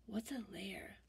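hushed question; whisper. Real, not acted. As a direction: whisper it like a secret. Reader asks child in whisper.

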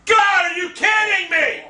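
high-pitched, incredulous shouting; real rage. Real, not acted. Gamer denied a kill, outraged yelling.

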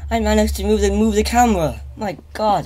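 high, keyed-up and loud; shaken. Real, not acted. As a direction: still rattled from a scare. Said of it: "never been so scared in my life".

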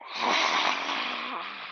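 long breathy exhale, tongue out; lion's breath exhale. Shown as a demonstration. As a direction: breathe out hard like a lion. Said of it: "One more time".

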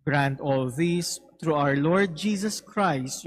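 usual speaking voice

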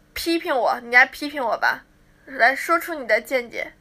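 ordinary delivery

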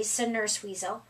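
usual speaking voice

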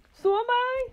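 high, loud frightened cry; wife shrieking she shrinks too. A narrator's reading. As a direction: shriek back in terror. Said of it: "cried Missus Twit".

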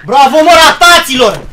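loud mocking bravo; sarcastic shout. Real, not acted. Gamer mocking teammates after a lost round. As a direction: shout the praise with scorn.

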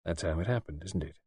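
a father gently guessing his daughter's secret, softly. A narrator's reading. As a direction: ease the truth out of her tenderly. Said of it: "and then said gently".

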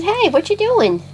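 voicing the pet, pitched high and chatty; cute pet voice put on for the animal. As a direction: speak for Choo Choo in a lifted, playful voice. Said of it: "He says".

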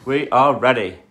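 loud declaration. Real, not acted. Parent announcing to viewers that they are ready, loud and punchy. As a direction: say it big for the audience.

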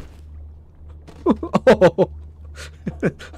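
high, airless burst of laughter; breathy giggle. Real, not acted. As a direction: giggle at your own bad joke. Laughing at his own joke.

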